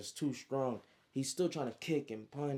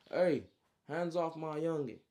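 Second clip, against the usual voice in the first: raised, higher protective shout; commanding call-out; 5 semitones higher, 4 dB louder than usual. Acted. Big homie warning the man choking his youngin, raised commanding call. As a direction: call out to stop an attacker.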